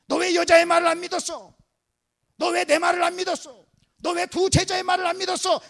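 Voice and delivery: loud, high scolding; shouted staged telling-off; harsh acted rebuke. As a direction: fire each accusing question out forcefully. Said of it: "이렇게 책망하는 것이 아니라".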